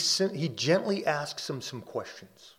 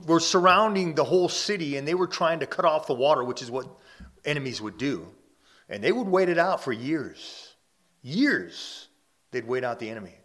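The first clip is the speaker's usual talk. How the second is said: louder, rising sermon push; building preaching; 4 semitones higher, 7 dB louder, faster than usual. Acted. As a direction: drive the point home to the congregation.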